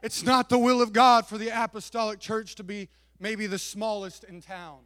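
loud, high, rising preacher's cry; preaching at full force. Acted. Pastor to his church, preaching loud and full. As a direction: preach it out, building to a roar.

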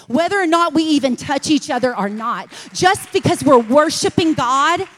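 loud, high preaching at full force. Acted. Preacher promising peace and joy in church, at full force. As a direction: shout it to the rafters.

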